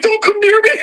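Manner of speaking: high plea breaking into laughter; retold alarmed plea. Woman warding off a ghost, high laughing mock alarm. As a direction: act out warding someone off, half laughing.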